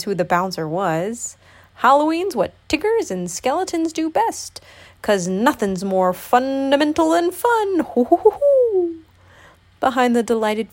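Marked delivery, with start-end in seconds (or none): 1.8-7.8 high-pitched exuberant tiger voice; hooting Tigger. A narrator's reading. Tigger boasts about Halloween, hooting excitedly. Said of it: "hooted Tigger". 7.9-9.0 high, loud gleeful hooting laugh; Tigger hoot. A narrator's reading. Tigger delights in Halloween fun, whooping hoots. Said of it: "hooted Tigger".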